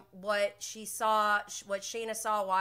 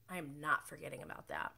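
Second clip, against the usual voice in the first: ordinary delivery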